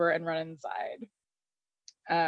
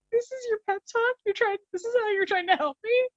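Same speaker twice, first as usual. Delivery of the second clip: much higher, incredulous mock whine; indignant Hardison; 13 semitones higher, faster than usual. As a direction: play him offended by a useless pep talk. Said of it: "And he's like".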